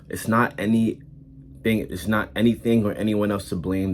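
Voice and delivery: usual speaking voice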